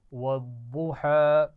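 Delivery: "वद्दुहा" slow and loud with a thick, heavy daad; teacher modelling a heavy daad. Shown as a demonstration. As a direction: recite "वद्दुहा" drawn out, daad full and heavy. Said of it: "मोटा पढ़ा जाएगा".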